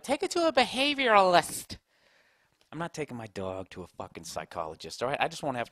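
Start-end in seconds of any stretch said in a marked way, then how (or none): 0.0-1.8 high-pitched mocking quote; mocking mimicry. Man mimicking someone's dog advice, high mocking voice. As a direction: parody the advice in a much higher voice.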